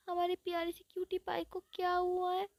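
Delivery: high, sugary voice, fussing over a cutie; doting high coo; cutesy fussing voice. Retold or imitated. Friends fuss over cute classmate.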